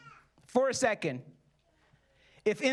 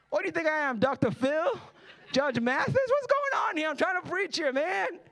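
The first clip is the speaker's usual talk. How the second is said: exasperated; 5 semitones higher than usual. Acted. Preacher as Jesus scolding a heckler, exasperated. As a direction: pitch climbing into comic indignation.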